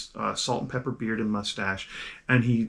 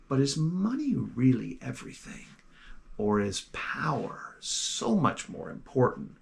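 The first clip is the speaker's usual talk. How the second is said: put-on hushed elderly tempter; old mentor; 5 dB quieter than usual. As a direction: lure him in quietly, like a wise old mentor.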